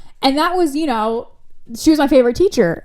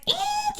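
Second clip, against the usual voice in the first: brakes screech; 8 dB quieter than usual. Retold or imitated.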